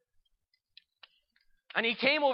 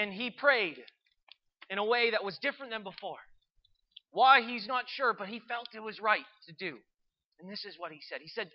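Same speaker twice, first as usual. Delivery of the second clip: ordinary delivery, nothing marked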